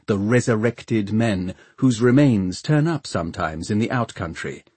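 plain reading voice

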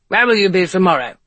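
forceful character voice, demanding; demanding commissioner. A narrator's reading. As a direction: question him as a brusque police chief. Commissioner questions Ethan in character voice.

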